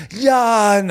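hammed-up high-pitched sympathy; mock commiseration. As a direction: wail it in exaggerated sympathy. Mock commiseration in acted exchange.

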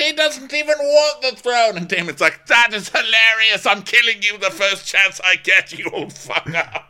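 high, loud mock outburst; hammed-up outburst. Damon threatens to kill the old adviser, hammed-up.

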